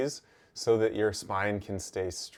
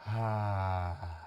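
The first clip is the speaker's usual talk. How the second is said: relaxed sigh; 4 dB quieter than usual. Shown as a demonstration. Teacher showing the breath slowing down, relaxed sigh. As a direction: let the breath go in a slow sigh.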